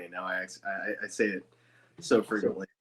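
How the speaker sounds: usual speaking voice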